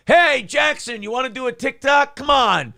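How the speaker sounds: high, loud catcall jeer; leering catcall. Retold or imitated. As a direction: heckle him like a catcaller. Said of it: "I'm gonna go".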